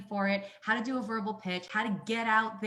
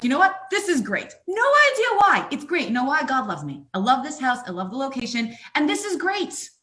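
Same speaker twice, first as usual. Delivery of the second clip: exaggerated delight; 5 semitones higher, 7 dB louder than usual. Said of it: "I was like".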